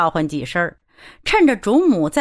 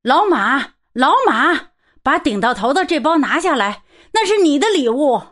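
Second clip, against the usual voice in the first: shouting from afar; 6 semitones higher than usual. Acted. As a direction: call out over an armful of parcels. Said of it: "他老远边喊".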